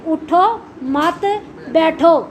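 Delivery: اٹھو, مت, بیٹھو slowly, one word at a time; words separated. Shown as a demonstration. Teacher counting the three identical words, saying اٹھو … مت … بیٹھو slowly and separately. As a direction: pause between اٹھو and مت and before بیٹھو. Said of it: "الفاظ وہی تین ہیں".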